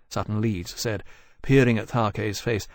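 plain reading voice

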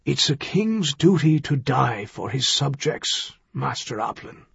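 soft, fading dying words; a dying king, gently insistent; weak dying voice. A narrator's reading. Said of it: "His voice was weak as his life flowed out".

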